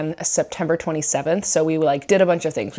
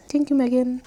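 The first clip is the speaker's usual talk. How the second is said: higher, lightly voiced dog thanking Megan; voicing the dog; 7 semitones higher than usual. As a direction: say thanks as the puppy.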